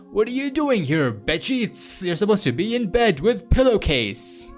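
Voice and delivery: high, playful telling-off; mock scolding a pet. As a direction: scold a cute cat in an exaggerated voice.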